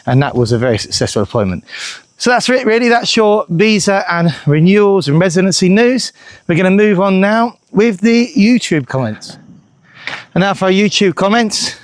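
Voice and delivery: usual speaking voice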